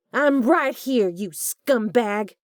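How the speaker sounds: high, loud defiant yell. A narrator's reading. Delaney rushing out yelling at Hugh, defiant yell. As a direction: yell it in his face. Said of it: "she yelled".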